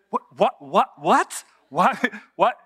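a hammed-up stunned mother stammering; baffled mother. Mary baffled by answer.

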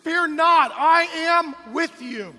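booming preacher proclamation, each line a cry; loud, high, fervent preacher shouting; preaching at full force. Acted. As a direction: thunder the promise over the crowd. Preacher proclaiming God's words.

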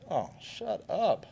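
Jesus just woken, slowly hushes the wind; sleepy rebuke. Acted. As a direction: groggily wave off the storm. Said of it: "and he goes".

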